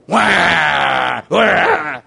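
mock monster shouts, loud; hammed-up monster yelling to scare; monster roar. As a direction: play a big monster trying to scare a child. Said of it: "So they will come".

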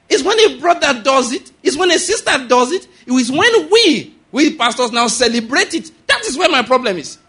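high, loud, impassioned pulpit cry; soaring preacher's climax; preaching at full force. Acted. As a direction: hammer each phrase home to the congregation.

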